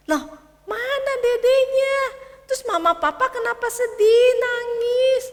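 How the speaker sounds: put-on high, loud child's voice; a worried little boy pleading to know; little boy asking. Michael anak kecil bertanya. (suara anak kecil yang cemas)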